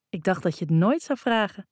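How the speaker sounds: ordinary delivery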